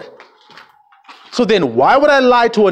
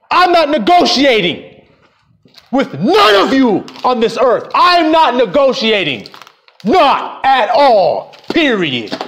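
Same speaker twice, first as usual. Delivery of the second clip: defiant declaration; 5 semitones higher, slower than usual. Real, not acted. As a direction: drag each word out, unbending.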